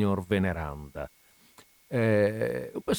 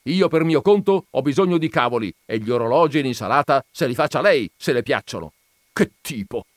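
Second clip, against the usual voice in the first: storming off; 6 semitones higher, 7 dB louder, faster than usual. Acted. Veneranda storms off.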